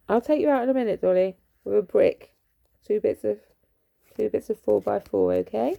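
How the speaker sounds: hammed-up loud local threat at the dog; mock local threat. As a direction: play the hard local bloke threatening Dolly. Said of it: "This is how we talk down this way".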